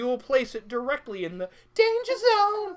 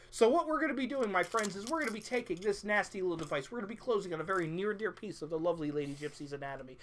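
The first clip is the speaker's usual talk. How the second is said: showman patter; 3 semitones lower, 5 dB quieter, faster than usual. Acted. Sideshow host patter.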